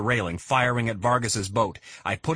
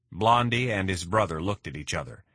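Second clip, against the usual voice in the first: plain reading voice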